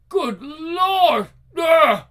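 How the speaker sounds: high shouted cry of horror; horrified cry. Acted. Murderer shrieks, overwhelmed by the heartbeat. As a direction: cry out in shock.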